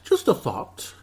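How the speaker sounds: high-pitched jokey put-on voice; playful, jumping up high; silly high voice. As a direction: repeat it in a silly squeak. Remark repeated in silly voice.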